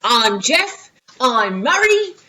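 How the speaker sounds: loud, slow put-on Australian accent; big children's-show greeting in a mock Australian accent. Teacher imitating an Australian kids' band, loud hammed-up Aussie accent. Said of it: "think about what they sound like".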